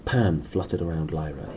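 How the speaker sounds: ordinary delivery, nothing marked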